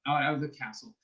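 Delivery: usual speaking voice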